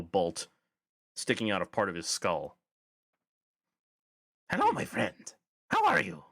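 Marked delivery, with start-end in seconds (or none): 4.5-6.2 very high-pitched friendly goblin; high, hailing goblin voice; goblin character, friendly. Acted. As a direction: greet them as an eager little goblin.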